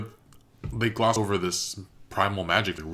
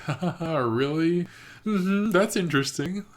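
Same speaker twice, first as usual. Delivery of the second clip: much higher, polite fake laugh, humoring him; doubtful Rayla; 10 semitones higher than usual. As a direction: play along while clearly not buying it. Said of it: "Rayla's kinda looking at him like".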